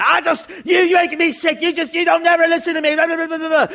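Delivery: high-pitched nagging rant; mock yelling wife. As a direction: imitate a wife railing in the car. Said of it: "she's yelling in your ear".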